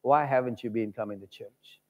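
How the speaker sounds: hushed stern-but-kind preacher; low gentle scolding. Retold or imitated. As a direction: gently scold the hidden girl. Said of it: "And he said".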